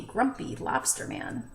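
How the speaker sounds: ordinary delivery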